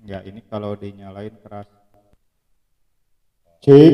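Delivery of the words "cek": loud check with booming echo; like a concert stage; cathedral echo. Shown as a demonstration. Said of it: "menggema banget ya kalau dinyalain mic-nya". (gema keras seperti di panggung)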